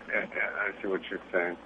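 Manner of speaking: usual speaking voice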